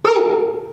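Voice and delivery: shot sound. Shown as a demonstration. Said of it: "He turns broadside".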